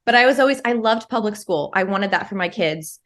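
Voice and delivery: ordinary talk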